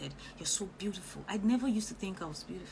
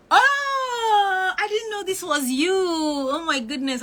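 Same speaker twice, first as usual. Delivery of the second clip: real delighted cry, much higher and louder; thrilled surprise; 12 semitones higher, 13 dB louder, slower than usual. Real, not acted. Coach spotting her new student in the comments, delighted shriek. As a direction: burst out in joy at a familiar name. Recognizes a commenter who registered.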